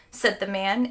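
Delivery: usual speaking voice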